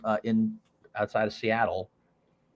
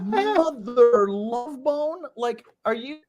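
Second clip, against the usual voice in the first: high, slow, amazed; stunned exclamation; 11 semitones higher, much slower than usual. Retold or imitated. Young salesman reacting to the sale, amazed. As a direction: draw it out in amazement.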